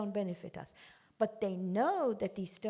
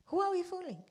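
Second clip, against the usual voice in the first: higher repeat; 8 semitones higher than usual. Shown as a demonstration.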